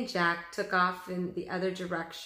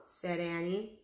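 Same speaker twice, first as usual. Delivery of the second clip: plain reading voice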